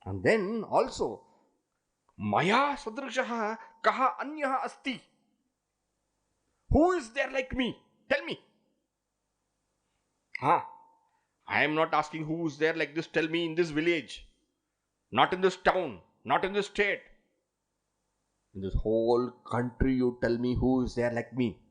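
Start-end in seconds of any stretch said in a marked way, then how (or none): 2.2-10.6 arrogant high challenge, who is like me; boastful challenge. Acted. Boaster challenging who equals him. 11.5-21.6 boastful challenge. Acted.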